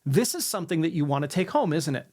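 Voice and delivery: "isn't it?" going down at the end; command tonality. Shown as a demonstration. Salesman to a client, command tonality with a falling "isn't it?". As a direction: let "isn't it?" fall like a statement. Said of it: "you're gonna use your command tonality".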